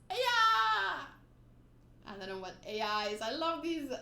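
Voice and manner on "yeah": loud, high rallying yeah; fired-up boy shouting his resolve; excited cheer. Acted.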